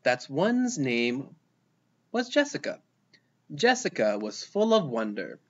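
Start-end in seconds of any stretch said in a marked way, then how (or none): none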